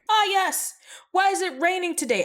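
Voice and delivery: high, put-on questioning noble; mock complainer. Nobles looking to blame Margaret, high mock complaint. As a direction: pitch it up like a whining subject.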